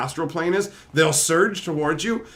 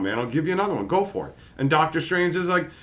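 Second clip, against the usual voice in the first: ordinary talk, nothing marked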